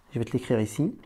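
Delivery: usual speaking voice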